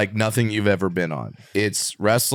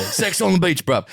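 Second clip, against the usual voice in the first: mock British bruv; 8 semitones higher than usual. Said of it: "Sorry, bruv".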